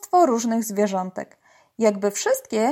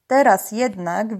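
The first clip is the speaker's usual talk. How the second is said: ordinary delivery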